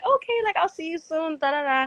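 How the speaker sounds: very high, sing-song see-you-soon; high, chirpy imitation of herself saying bye; playful goodbye. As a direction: chirp it like a friendly farewell. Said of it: "but I was just like".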